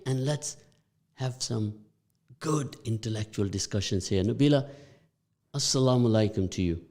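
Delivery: ordinary talk, nothing marked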